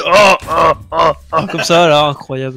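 strained, fading voice; lost voice. Real, not acted. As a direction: voice worn out from shouting. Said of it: "j'ai plus de voix".

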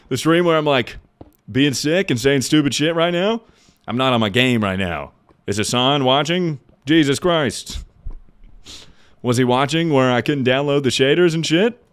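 loud, high flustered rant; embarrassed panic. Real, not acted. Streamer mortified Hassan saw his bad stream, loud and high.

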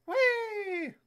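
high, long falling squeal; high "whee" sliding down; gleeful whee. Real, not acted. Flying off the map.

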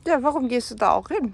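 loud exasperated question to an animal; scolding. Real, not acted. (laut vorwurfsvoll)

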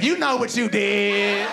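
high, drawn-out accusation; slow mock-accusatory cry; accusing woman. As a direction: stretch it out in an acted quarrel. Acted quarrel, woman accusing man.

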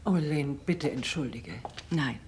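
ordinary talk, nothing marked

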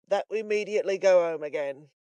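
loud, weighty character voice; fattest man. A narrator's reading. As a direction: hand it down as an order. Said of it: "said the fattest man of the party".

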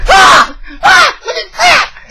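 high, loud, hammed-up alarm; mock panicked shriek. Man retelling a stranger kissing him, mock panicked shriek. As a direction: shriek like you've been grabbed, half joking. Retelling being kissed by a guy.

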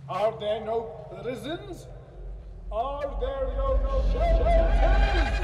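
mocking echo. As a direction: throw his own cruel words back at him. Spirit throws his words back.

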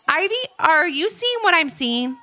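can't believe your eyes; surprised and excited. A narrator's reading. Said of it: "sounding surprised and very excited".

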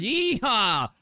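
high, loud mock yeehaw; cowboy whoop. Viewer joking about the name Texas, cowboy whoop. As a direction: let out a playful rodeo holler.

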